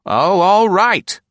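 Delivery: high, loud and slow, giving in with a pout; high put-on woman's voice, sulking; sulky. A narrator's reading. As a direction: give in grudgingly. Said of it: "Nora said sulkily".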